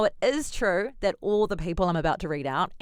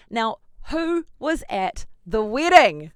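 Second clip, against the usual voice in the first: dramatic announcer voice; 5 semitones higher, 8 dB louder, slower than usual. Pretend.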